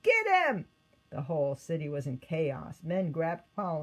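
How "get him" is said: much higher, louder yell; mob shout. Acted. Angry crowd turning on Paul, loud yell.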